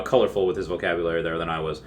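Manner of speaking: ordinary talk, nothing marked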